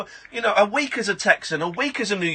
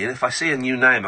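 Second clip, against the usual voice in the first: ordinary delivery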